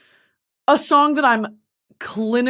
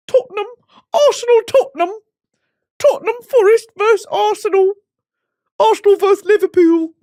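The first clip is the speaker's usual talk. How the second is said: football announcer; 11 semitones higher, 5 dB louder than usual. Pretend. Mock football chant.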